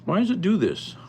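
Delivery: real irritated exclamation; exasperated. Real, not acted. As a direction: vent at the stubborn computer. Import failed on computer.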